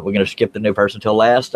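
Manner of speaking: usual speaking voice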